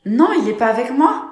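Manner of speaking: high, loud panicked denial; alarmed outburst. Acted. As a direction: blurt it out in alarm. Fiancé disparu, panique. (éclat paniqué, plus aigu et fort)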